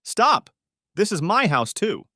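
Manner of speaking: high, loud protest; desperate shout. Acted. Wife shouting at her husband to stop, desperate. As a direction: cry out to make him stop.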